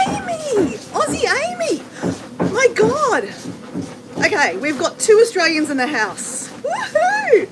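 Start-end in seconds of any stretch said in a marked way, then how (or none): 0.1-3.3 high, drawn-out delighted squeal of a greeting; pitched way up, thrilled; excited greeting. Real, not acted. Delighted greeting as friend joins. 6.5-7.5 high, whooping celebration; real high-pitched cheer; excited cheer. Real, not acted. Cheers Australians in the house.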